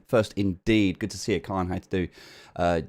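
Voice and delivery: ordinary delivery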